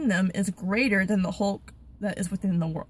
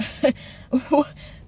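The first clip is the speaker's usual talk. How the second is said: breathy scornful laugh of a giant; hammed-up villain laugh; giant's mocking laugh; 5 semitones higher than usual. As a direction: sneer out a laugh at the boy. Goliath mocking David.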